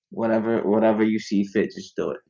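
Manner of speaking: ordinary talk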